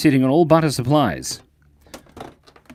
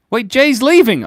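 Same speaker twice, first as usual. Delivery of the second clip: surprised; 11 semitones higher, 7 dB louder than usual. Real, not acted. Noticing a viewer leave.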